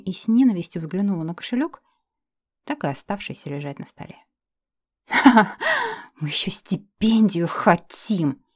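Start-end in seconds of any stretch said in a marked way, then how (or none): 5.1-8.4 slow, hissed sneer; hissing through teeth. Acted. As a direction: hiss it mockingly through teeth. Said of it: "прошипела я сквозь зубы".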